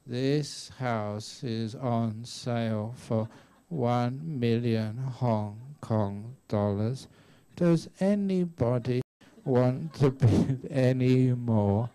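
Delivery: dragged-out, sleepy auction call with a laugh at the end; an auctioneer who can't be bothered; painfully slow bid call. Retold or imitated. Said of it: "imagine if the auctioneer was very slow".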